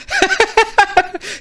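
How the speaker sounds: helpless high-pitched cackle; high giggling laugh. Real, not acted. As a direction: burst into a rapid, high giggle. Laughs at doomed deputy.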